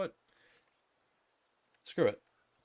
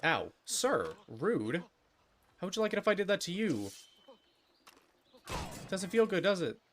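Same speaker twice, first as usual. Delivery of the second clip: higher, louder, offended scolding; mock indignant; 11 semitones higher, 8 dB louder than usual. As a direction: huff at the rude stranger. Scolding game character who shoved him.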